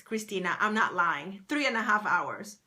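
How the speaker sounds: ordinary talk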